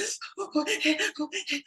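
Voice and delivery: sharp, high gasp of alarm; mock gasp of fright; startled gasp. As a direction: gasp as if caught and looking for a way out. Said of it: "'cause you're like".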